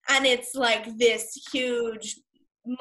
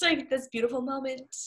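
ordinary delivery

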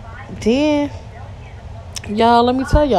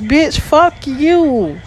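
slow drawn-out curse; 6 semitones higher, much slower than usual. Real, not acted. Cursing out a group commenter.

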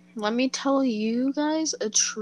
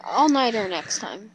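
ordinary delivery

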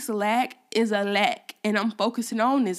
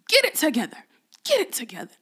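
hard on herself; 5 semitones higher, faster than usual. Real, not acted. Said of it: "can you see how I'm hard on myself?".

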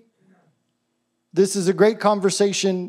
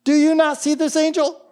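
high-pitched mock donkey voice; talking donkey; 8 semitones higher than usual. Donkey turning round to question Balaam, high mock incredulity. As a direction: put on a higher voice for an incredulous animal. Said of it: "the donkey turns around and goes".

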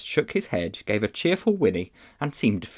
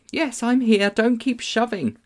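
put-on high-pitched, cross child's reply; irritated girl. A narrator's reading. Polly answering Diggory in the dark, cross high voice. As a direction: answer testily in a high girl's voice.

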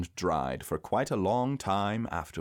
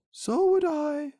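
slow, very high bear voice; Pooh; 5 dB louder, slower than usual. A narrator's reading. Pooh agreeing with Piglet, high slow voice. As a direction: agree slowly, pitched way up and louder. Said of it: "said Pooh".

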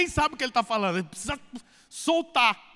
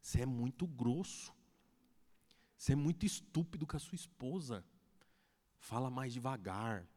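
much softer and lower, confiding; gentle quiet counsel; 10 semitones lower, 12 dB quieter than usual. Retold or imitated. Cell leader quietly confronts rude husband, softly. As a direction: lean in and correct him gently. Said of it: "o líder de célula chega perto de você e fala".

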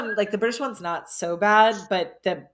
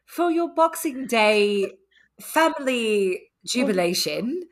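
slow, high British commercial read; stretched-out, hammed-up British ad voice; British accent; 6 semitones higher, slower than usual. Theater major improvising a Kohl's ad, British accent. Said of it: "do a commercial for Kohl's".

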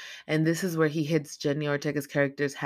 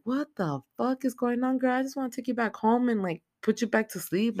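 high-pitched imitation of alarmed disbelief; mock bewildered pleading; 8 semitones higher than usual. Brittany's character to wandering naked grandma, acted bewildered pleading. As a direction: act out baffled concern, pitched up, easing down.

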